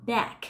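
"Back" with a tense a and a crisp k; "Back" as /bæk/, final k released; quiz back. Shown as a demonstration. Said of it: "Thế còn từ này thì sao".

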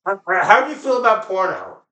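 ordinary talk, nothing marked

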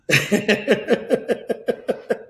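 bright burst of laughter; high laugh. Real, not acted. Laughing after the catchphrase, high laugh. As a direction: laugh at your own joke.

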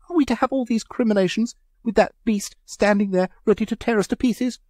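high, loud, quick indignant wail. A narrator's reading. Mavis wailing at the others, indignant. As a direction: complain in outraged panic. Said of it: "wailed Mavis indignantly".

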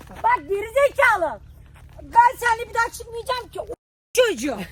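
loud, exasperated tirade; angry shouting. Real, not acted. Victim swearing off outings with his friend, angry shout. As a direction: yell insults at the prankster. Furious at the prankster.

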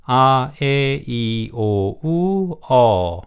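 "아, 에, 이, 오, 우, 어" drawn out one by one; teacher sounding out "아, 에, 이, 오, 우, 어" as held vowels; vowel sound demonstration. Shown as a demonstration. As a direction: sustain each vowel of "아, 에, 이, 오, 우, 어" clearly. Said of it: "이런 발음들을 모음이라고 합니다".

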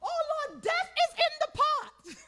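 high, drawn-out alarmed wail; crying out. Acted. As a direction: cry out in hammed-up panic. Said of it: "somebody is crying out".

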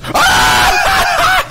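sudden high scream of fright; startled scream. Real, not acted. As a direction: shriek out in a jump scare. Sudden scare in horror game.

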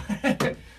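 breathy burst of laughing; real laughter. Real, not acted. Admitting the British accent is put on, laughing. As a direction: laugh at yourself, caught out.

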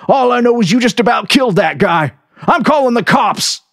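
high, loud ordering voice; angry cook. A narrator's reading. Cook ordering the narrator to leave, angry. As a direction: order him off the lot. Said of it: "he said".

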